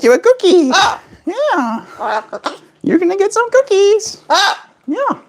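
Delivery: very high, giggly cooing over treats. Real, not acted. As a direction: squeal with delight at the bird. Offering bird cookies.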